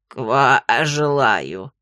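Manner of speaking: low, slow croaking toad; gurgling frog voice. A narrator's reading. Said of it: "пробулькала она".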